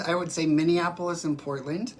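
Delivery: ordinary talk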